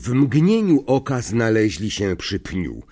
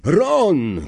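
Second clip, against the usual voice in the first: shouted name; 7 semitones higher than usual. A narrator's reading. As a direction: yell after the boy being dragged away. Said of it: "krzyknął Harry".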